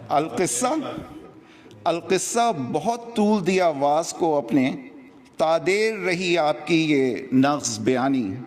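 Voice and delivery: measured Urdu verse recital, pitched above chat; declaimed mushaira-style verse with lilting line endings; poetry recitation. Acted. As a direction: recite each couplet to the audience, pausing between phrases. Resumes reciting the poem.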